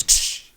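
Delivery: loud hissed "ksh"; imitated sound effect. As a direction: make a sudden whooshing hit noise. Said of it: "just a hand goes".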